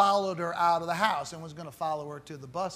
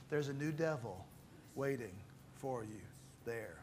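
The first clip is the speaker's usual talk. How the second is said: fading hush; 8 semitones lower, 15 dB quieter than usual. Real, not acted. Ominous fading warning.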